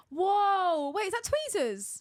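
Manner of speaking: gasp, then a loud, high whoa; amazed gasp. Real, not acted. Co-host seeing the nail set revealed, amazed gasp and exclamation. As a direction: react with big amazement.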